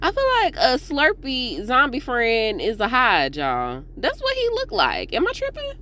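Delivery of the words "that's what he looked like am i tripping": high incredulous squeal; squealing am I tripping. Real, not acted. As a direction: ask it in high disbelief. Joking about zombie look.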